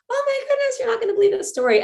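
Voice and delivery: very high, fast breathless excitement; excited gasp. Retold or imitated. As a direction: gush out the big news in a rush. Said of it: "I'm like".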